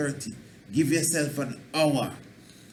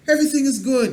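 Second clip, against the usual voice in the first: everything is good, said much higher and louder; upbeat declaration; 11 semitones higher, 7 dB louder, faster than usual. Shown as a demonstration. Teacher shows the upright, positive way to answer. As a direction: lift up and announce it brightly. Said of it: "But when you say".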